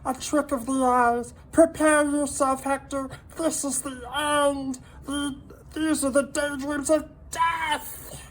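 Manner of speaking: high, loud, dramatic prophecy of death; doom-laden salamander. A narrator's reading. Minton declaring their doom to Hector, loud and grim. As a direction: proclaim the end like a tiny prophet. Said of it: "said Minton darkly".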